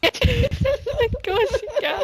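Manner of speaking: very high, slow squeal; squeaky high voice. Acted. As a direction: squeak it out in a silly high pitch. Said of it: "That's all I've got".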